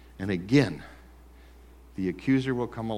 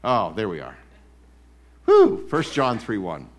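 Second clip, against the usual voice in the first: ordinary delivery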